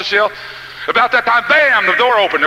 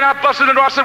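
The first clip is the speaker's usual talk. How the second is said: usual speaking voice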